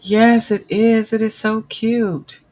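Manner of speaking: gushing. Real, not acted. Gushing over something in chat.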